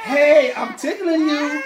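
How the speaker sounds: high, slow, playful mom voice; voicing mom. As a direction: tease the baby like a tickling mom. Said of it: "she was like".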